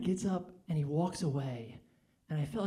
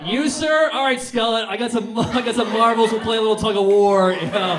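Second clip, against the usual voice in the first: much louder, high and hyped throughout; loud crowd work; 4 semitones higher, 11 dB louder than usual. Real, not acted. Comic wrapping up, yelling at the crowd about his urethra. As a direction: shout it to the whole room with rising energy.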